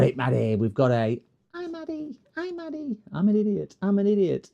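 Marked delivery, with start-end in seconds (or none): 1.5-3.0 squeaky high mock voice; high, soft, taunting. Mocking voice greeting Maddie. 3.1-4.5 mocking voice. Mocking self-insult voice.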